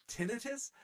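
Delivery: "tinnitus?" as TIN-uh-tus, high and rising; disgusted first-syllable stress. Shown as a demonstration. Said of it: "oh, I hate it".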